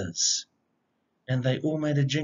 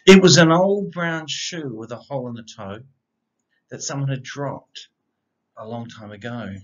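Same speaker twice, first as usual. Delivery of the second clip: bouncy rhyming read-aloud, opening lines louder; picture book reading; 2 semitones lower, 6 dB louder than usual. A narrator's reading. Reading a rhyming dog story aloud to children, lively storyteller voice. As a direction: read it like a bedtime story for kids.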